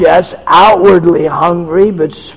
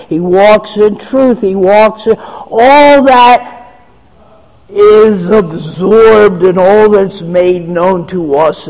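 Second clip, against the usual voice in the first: preaching at full force; 3 semitones higher, 3 dB louder than usual. Acted. Preacher proclaims to the congregation, fervent and rising. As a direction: build the list like a pulpit crescendo.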